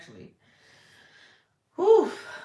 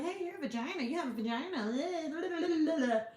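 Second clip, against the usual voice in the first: put-on silly child voice; 9 semitones higher than usual. Imagined child talking to girls.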